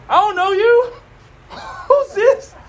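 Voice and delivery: high-pitched comic suspicion; squeaky-high awkward recoil, hammed up; mock wariness. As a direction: act put off by a stranger, playfully. Mimics wary people greeting neighbors.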